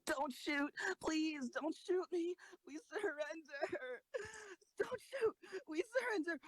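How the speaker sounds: high, crying pleas, slow and broken; terrified girl begging for her life; tearful pleading. Acted. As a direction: sob out the surrender, pitch soaring. Begging soldiers not to shoot.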